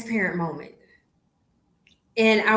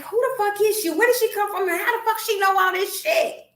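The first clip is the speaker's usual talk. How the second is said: much higher, indignant gossip voice; imitating puzzled strangers; 12 semitones higher, 4 dB louder than usual. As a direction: play the suspicious onlooker. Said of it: "'cause it's like".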